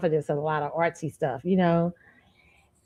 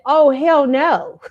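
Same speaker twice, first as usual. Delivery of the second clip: slow, higher, louder sassy protest; indignant; 9 semitones higher, 7 dB louder, much slower than usual. Retold or imitated. Imitating classmates rejecting the comparison, sassy and slow. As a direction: drag it out in mock outrage.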